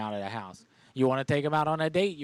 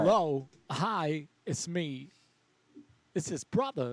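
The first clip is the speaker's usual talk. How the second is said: pretend brother; 3 semitones higher than usual. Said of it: "let me get my brother".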